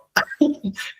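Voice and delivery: a light giggle up high; airy amused giggle; breathy high laugh. Real, not acted. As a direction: laugh it off softly. Laughing mid sentence.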